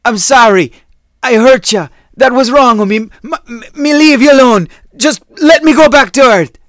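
high, loud pleading yell; desperate shouting. Acted. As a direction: pound the door and scream for mercy. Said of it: "knocking and shouting".

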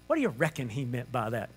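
low, dopey wondering voice; puzzled disciple. Acted. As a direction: wonder aloud in a low register. Dim disciples puzzling over Jesus' words.

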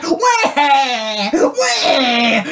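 a mock crying little-boy wail; hammed-up crying baby shout; imitating George from Peppa Pig. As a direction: whine like a toddler who lost something. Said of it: "Are you George from Peppa Pig? Where he's like".

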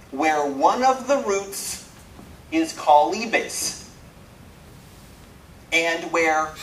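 slow, weighty reading-out of a rule; deliberate recital. Retold or imitated. Programmer reciting to the audience what the magic metafunction says, slow and emphatic. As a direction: stretch each phrase out with pauses between.

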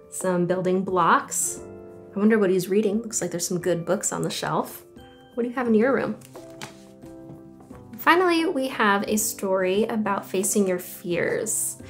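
ordinary delivery, nothing marked

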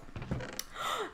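long, soft intake of breath; startled gasp. Real, not acted. Player spotting an emerald, startled gasp. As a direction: gasp at a treasure.

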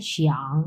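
শিয়াং drawn out in a dipping V-shaped tone; third tone. Shown as a demonstration. Teacher models a new word for students, dipping third tone on শিয়াং. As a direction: let শিয়াং fall, then rise. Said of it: "V shape উচ্চারণ করবেন".

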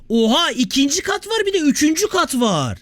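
high-pitched amazed outburst. Real, not acted. Discovers extra floors in the game. (şaşkınlıkla, heyecanla)

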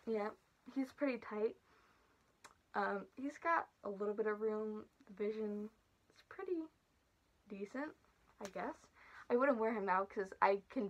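muffled inside mask head; through a costume head. Real, not acted. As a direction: voice behind full head cover. Seen 4: Full costume head worn over face throughout the labelled words.

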